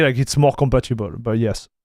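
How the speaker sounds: ordinary talk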